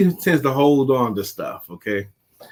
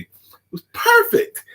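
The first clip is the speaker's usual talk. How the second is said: high slow gleeful; slower than usual. Real, not acted. Gleeful high drawl, laughs after.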